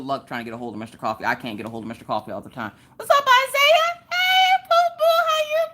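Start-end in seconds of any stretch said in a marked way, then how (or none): 3.0-5.6 much higher and louder, slow and stretched; loud falsetto greeting. Real, not acted. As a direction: squeal a hello to a viewer.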